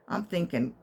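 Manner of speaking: normal talking voice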